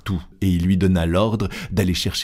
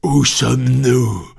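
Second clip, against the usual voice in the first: louder, higher, slow furious repeat; red-faced angry shout; 5 semitones higher, 5 dB louder, slower than usual. A narrator's reading. Furious captain repeats his question to Fogg, louder. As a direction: bellow it the second time, purple with rage. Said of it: "répéta-t-il, la face congestionnée".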